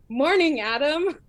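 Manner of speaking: forced greeting through clenched teeth; through gritted teeth. Acted. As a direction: grit your teeth and force it out. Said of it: "through gritted teeth goes".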